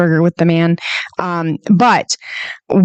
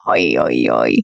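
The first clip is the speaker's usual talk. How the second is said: exasperated oy. Real, not acted. Groan of exasperation.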